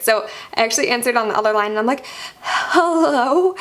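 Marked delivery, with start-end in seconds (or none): 2.4-3.5 high, drawn-out hello through pretend tears; imitated upset phone voice; mock tearful greeting. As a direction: answer the phone as if trying not to cry. Crying caller answers business call.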